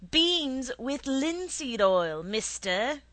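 loud, high, drawn-out call. A narrator's reading. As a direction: call from some yards away. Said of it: "called out to the man".

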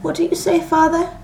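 high-pitched query; daughter questioning. A narrator's reading. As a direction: call on your father to say it properly. Daughters question dozing father.

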